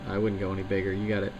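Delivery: ordinary delivery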